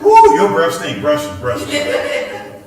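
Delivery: high, loud yelp of disgust, then slow insistent orders; disgusted yell. Retold or imitated. Parent recoiling at a kid's bad breath, loud disgusted yelp. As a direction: recoil from the smell and order them to brush.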